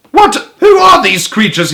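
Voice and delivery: high, loud, incredulous yell; outraged bellow. A narrator's reading. Duke seeing giraffe and monkey, incredulous bellow. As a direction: bellow in disbelief. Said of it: "he bellowed".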